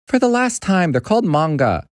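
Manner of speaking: put-on high-pitched exasperated kid; frustrated boy. Boy correcting his dad about manga, frustrated. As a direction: protest in a high, frustrated boy's voice. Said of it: "let out a sigh of frustration".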